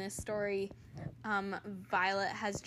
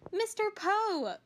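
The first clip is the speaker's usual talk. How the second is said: much higher, slow despairing plea; 11 semitones higher, 4 dB louder, slower than usual. Acted. Violet pleading with Mister Poe, high despairing voice. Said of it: "Violet said in despair".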